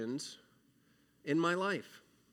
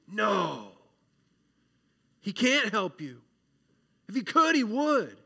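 harsh doubt; 5 semitones higher, 6 dB louder than usual. Acted. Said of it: "our doubt inside says".

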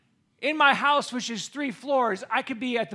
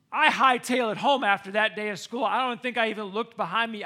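ordinary delivery

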